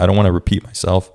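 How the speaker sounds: ordinary talk, nothing marked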